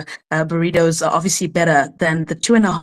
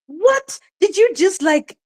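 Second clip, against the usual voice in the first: indignant; 6 semitones higher than usual. Real, not acted. Outraged at being dismissed.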